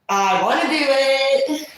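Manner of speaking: loud, high, drawn-out whine; hammed-up pleading, stretch every word; mock insistent plea. Acting out her insistent plea.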